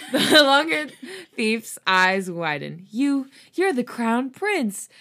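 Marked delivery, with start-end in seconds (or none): 2.9-4.9 slow, loud, stunned recognition; astonished. Acted. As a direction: gasp it out in disbelief. Thief recognizes the prince.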